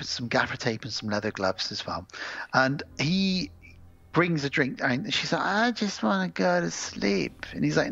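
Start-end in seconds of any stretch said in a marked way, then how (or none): 5.4-7.5 higher, very slow drowsy whine; drunk sleepy woman. Acted. Drunk woman to her date, slow sleepy voice. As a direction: drag the words out drowsily.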